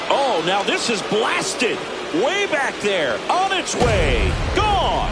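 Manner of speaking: building excited play-by-play call; rising to a shout on the last word; home run call. Real, not acted.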